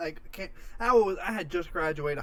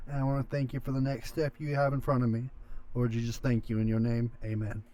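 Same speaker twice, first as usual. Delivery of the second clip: simple prayer; 4 semitones lower than usual. Acted. Leads the stream's closing prayer.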